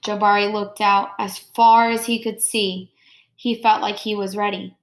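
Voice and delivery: expressive picture-book read-aloud; reading to children. Acted. Teacher reading Jabari Jumps to her class, expressive read-aloud. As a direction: read the story warmly to the class, pausing on each step.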